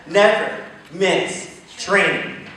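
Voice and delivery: "never miss training" said very slowly; slow emphatic. Shown as a demonstration. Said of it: "everyone just say that".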